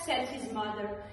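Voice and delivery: ordinary delivery, nothing marked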